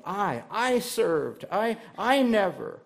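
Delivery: "I, I served" slow, leaning hard on "I"; "I" hammered in the brother's complaint; stressed I. Retold or imitated. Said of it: "Notice the repetition".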